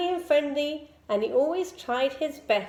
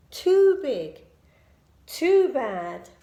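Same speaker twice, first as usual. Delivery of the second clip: louder dramatic; 7 dB louder, slower than usual. A narrator's reading. Menacing bear telling.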